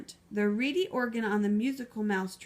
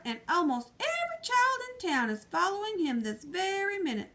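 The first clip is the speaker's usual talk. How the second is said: alarmed sheriff; 8 semitones higher than usual. Acted.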